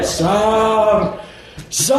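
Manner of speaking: high, drawn-out name call; imitated calling out. Mike calling Sam outside among neighbors, imitated calling out. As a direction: holler the name, long vowel.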